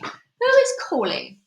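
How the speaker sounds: loud, slow and cold witch snap; witch snapping. Acted. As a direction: bark it out like a suspicious witch. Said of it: "snapped the Grand High Witch".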